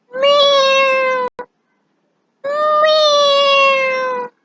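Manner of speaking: long, very high drawn-out meows; cat meow. Shown as a demonstration. Teacher showing what a cat says, long high meowing. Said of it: "A cat says".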